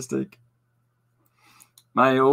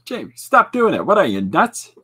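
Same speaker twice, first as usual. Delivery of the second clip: put-on yelling at child; 9 semitones higher, 8 dB louder than usual. Yelling at daughter.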